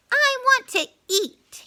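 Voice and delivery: high, loud holler; a hungry spider's high yell. A narrator's reading. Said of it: "he hollered".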